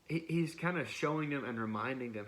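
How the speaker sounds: ordinary delivery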